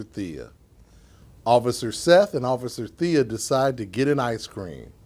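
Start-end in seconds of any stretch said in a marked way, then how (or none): none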